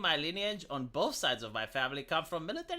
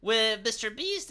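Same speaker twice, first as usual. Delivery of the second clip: normal talking voice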